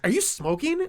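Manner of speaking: high, indignant snob imitation; snooty older man. Older man confronting a smoker at the next table, snooty.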